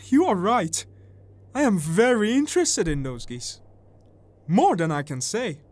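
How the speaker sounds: high, loud, eager agreement; excited little man. A narrator's reading. Little man admitting his interest in the geese, excited and loud. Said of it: "said the little man excitedly".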